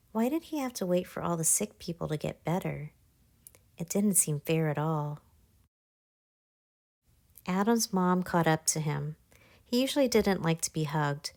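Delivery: ordinary delivery, nothing marked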